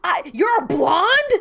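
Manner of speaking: outraged disbelief building to a high shout; mock-furious, rising into a loud shriek; mad. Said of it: "I was, like, actually mad".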